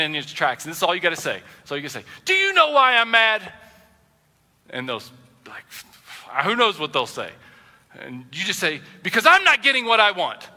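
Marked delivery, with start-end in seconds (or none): 2.2-3.5 raised, higher, louder challenge; mock-mad outburst, pitched up; acted marital anger. Models a line for arguing spouses. 9.0-10.5 acted frustration. Models answer in spouses' argument.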